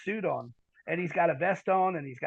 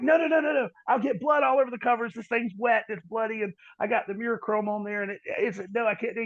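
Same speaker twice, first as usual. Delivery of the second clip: raised-pitch objection, settling slightly as it goes; retold frantic refusal; 7 semitones higher than usual. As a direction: protest urgently, re-enacting the alarm.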